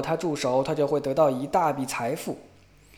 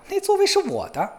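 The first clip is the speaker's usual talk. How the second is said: 大声嚷嚷; 5 dB louder than usual. A narrator's reading. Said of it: "大声嚷嚷说".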